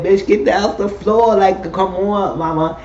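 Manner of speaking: high, mocking order to get off the floor; sassy put-down. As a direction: shoo her off with attitude. Judges ordering Candy off stage.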